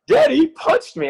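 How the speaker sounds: high-pitched mock-tearful kid voice; imitated crying child. Son tells his dad he got hit, imitated crying. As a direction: plead like an upset little boy, imitated. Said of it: "and he's crying".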